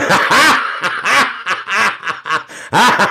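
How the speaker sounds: high, rising laughter; a high, rising burst of laughter. Real, not acted. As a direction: laugh in delight at the comment. Laughs at viewer comment.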